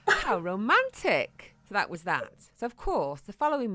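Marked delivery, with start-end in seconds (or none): 0.1-1.5 sarcastic. Retold or imitated. Sarcastic reply to husband.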